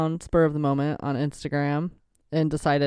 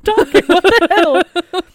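yelling; 13 semitones higher, 11 dB louder than usual. Real, not acted. Mock outrage at cohost.

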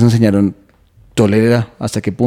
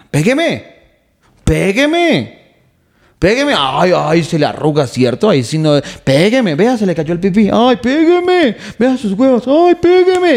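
much higher, taunting woman's voice, rising to a near shriek; mocking girlfriend; 11 semitones higher, 4 dB louder than usual. Comedian acting out girlfriend taunting boyfriend, high mocking woman's voice. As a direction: goad him like a nagging girlfriend, high and teasing. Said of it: "que la vieja le dice al man".

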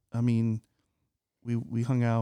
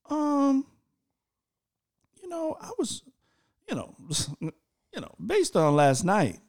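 awkward hesitation; 12 semitones higher than usual. Retold or imitated. Acts awkward girlfriend question by phone.

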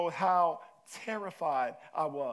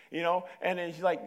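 normal talking voice

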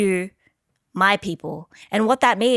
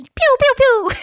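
pew pew gun sounds; 7 dB louder, slower than usual. Pretend. Imitating gunshots.

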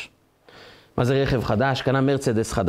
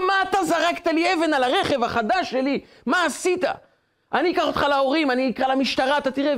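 acted angry scolding; 12 semitones higher than usual. Driver confronts boy who hit his new car, acted scolding. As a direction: tell off a child in mock outrage.

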